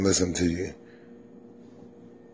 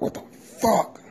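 high, sudden what the fuck; a high startled yelp of a curse; startled cuss; 13 semitones higher than usual. Retold or imitated. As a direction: blurt it out, caught off guard. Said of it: "I'm like".

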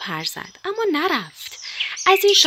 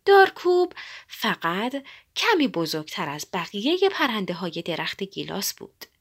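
plain reading voice

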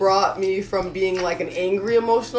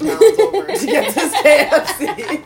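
laughing; 8 semitones higher, 8 dB louder than usual. Retold or imitated. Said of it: "and I'm like".

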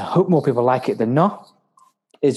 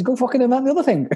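much higher and fast, cheeky comeback; mock retort; 10 semitones higher, faster than usual. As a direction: fire it off high and quick, teasing. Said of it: "I says".

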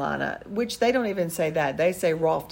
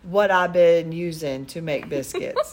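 slow Southern drawl imitation; slower than usual. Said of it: "His mom says".

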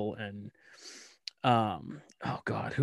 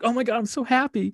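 much higher and louder, gleeful; mock delight; 13 semitones higher, 5 dB louder than usual. Dad thrilled about the model-train boyfriend, gleeful high voice. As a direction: gush with delighted glee. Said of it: "And I'm like".

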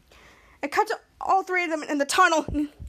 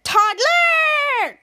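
much higher, louder call; 10 semitones higher, 5 dB louder than usual. Retold or imitated. Max calling out to the toddler, loud. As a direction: shout the name across.

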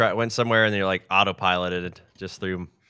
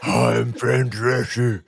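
slow, loud celebrity impression; Fran Drescher; 6 dB louder, much slower than usual. Podcaster mocking a worn-out throat, loud slow impression. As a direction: stretch it out in a loud put-on celebrity voice. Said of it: "I'm gonna sound like Fran Drescher".

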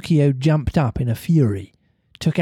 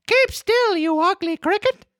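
Pinocchio voice. A narrator's reading. Pinocchio shouts at the cricket.

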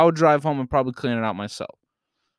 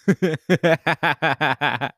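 quick staccato chuckle burst; laughing; 3 semitones higher than usual. Real, not acted. Storyteller laughs after banning friend from front seat, staccato chuckle. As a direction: chuckle in a rapid run.

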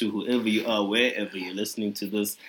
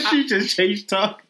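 high laughing disbelief; 11 semitones higher, 6 dB louder than usual. Real, not acted.

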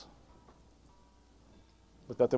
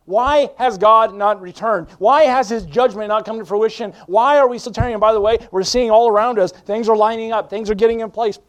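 louder, higher revival preaching; preaching at full force; 8 semitones higher, 8 dB louder than usual. Acted. Preacher urging the church toward revival, loud and fervent. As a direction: thunder hope over the congregation.

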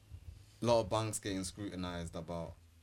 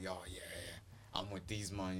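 quiet mock voice; 4 semitones lower, 13 dB quieter, slower than usual. Voicing himself supporting a party.

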